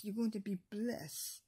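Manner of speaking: ordinary delivery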